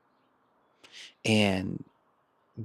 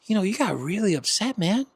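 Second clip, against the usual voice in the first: gentle check-in; 4 semitones higher than usual. Retold or imitated. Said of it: "you're like".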